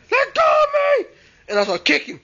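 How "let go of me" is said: terrified yell. Retold or imitated. Boy kicking the ghost off, yelling high. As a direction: shriek while kicking her off.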